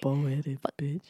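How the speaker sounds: low, slow muttered insult; under the breath. Shown as a demonstration. Showing the mean things said privately, muttered under the breath. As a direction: drop it low and soft, barely meant to be heard. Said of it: "say mean things under my breath".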